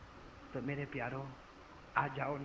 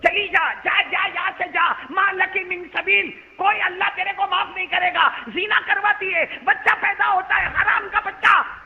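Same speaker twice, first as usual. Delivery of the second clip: higher, louder, slow angry yelling; furious shouting; 11 semitones higher, 10 dB louder than usual. Acted. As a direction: drive her out with every word. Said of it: "Abu Huraira गुस्से में खड़े हुए". (ग़ुस्से में चिल्लाकर भगाना)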